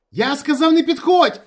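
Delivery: very high, loud frantic shout; full-out panicked yell; hysterical scream. A narrator's reading. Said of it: "істерично закричав Ніколаєч".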